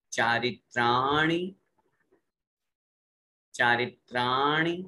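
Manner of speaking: चारित्राणि slowly with long त्रा and short णि; recitation demo. Shown as a demonstration. Teacher modelling pure pronunciation for students. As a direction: chant चारित्राणि for the class to echo. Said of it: "अब पहले शुद्ध उच्चारण".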